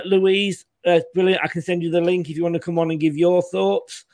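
normal talking voice

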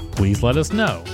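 normal talking voice

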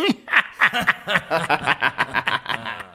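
a jeering laugh; mocking laugh. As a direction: laugh at her.

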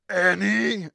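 high, loud Watto. Acted. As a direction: squawk it like Watto. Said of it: "character voice, uh, I chose a little unexpected, uh, cameo".

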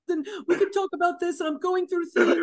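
mock tearful whining apology; fake crying. Imitating cheating partner begging forgiveness, fake crying. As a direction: imitate phony sobbing, high-pitched. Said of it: "he started fake crying".

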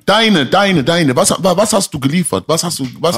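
loud and high, pressing hard for an answer; angry demand. Real, not acted. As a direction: hammer him with the question. Heated argument, demanding answers.

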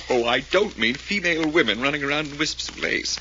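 chuckling through the words; laughing reply. Acted. To friend's odd phrase, amused and laughing. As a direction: laugh it off as you answer.

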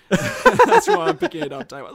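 bursting into loud high laughter mid-sentence; laughing. Real, not acted. Joking the game is free.

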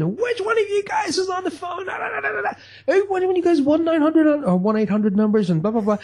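hammed-up angry dad. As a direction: play the furious dad over the phone bill, half laughing. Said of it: "you start screaming, you shout".

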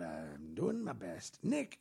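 ordinary talk, nothing marked

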